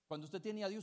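ordinary talk, nothing marked

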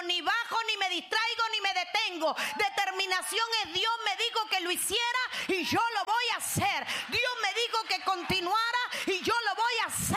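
firm, unshakeable vow; determined declaration. Acted. Nehemiah's declaration, preached loudly.

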